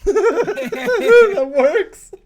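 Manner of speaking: loud, high, laughing out the words. Real, not acted. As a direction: burst out laughing. Laughing at the witch trap.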